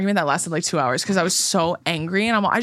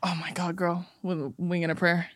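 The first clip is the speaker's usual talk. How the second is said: breathy whisper, much softer; whispered aside; 7 dB quieter than usual. Real, not acted. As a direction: whisper it like a secret. Reacting to wig question.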